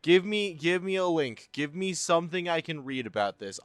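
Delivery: high, eager pleading on every please; excited begging. Real, not acted. Streamer begging chat for GTA leak details, high eager pleading. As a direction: beg the chat for the juicy link.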